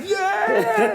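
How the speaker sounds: a huge high whoop into the camera; mock-hyped yell of yeah; wild cheer. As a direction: whoop it up like a crazed fan. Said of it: "like this".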